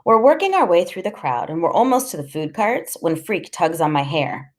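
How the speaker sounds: usual speaking voice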